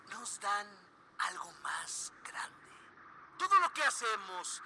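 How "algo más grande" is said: hushed secretive whisper. Acted. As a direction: breathe it like a secret. Cult leader's hushed promise.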